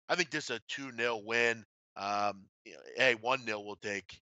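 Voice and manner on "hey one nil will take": normal talking voice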